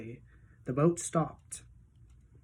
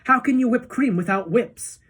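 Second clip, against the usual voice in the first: mock indignation; 6 semitones higher, 5 dB louder than usual. A narrator's reading. Wonka's mock-logical retort.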